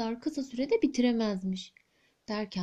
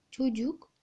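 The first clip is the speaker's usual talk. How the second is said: usual speaking voice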